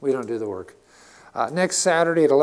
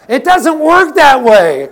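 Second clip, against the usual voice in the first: emphatic shout; 10 semitones higher, 13 dB louder, slower than usual. Real, not acted. Preacher's emphatic punchline.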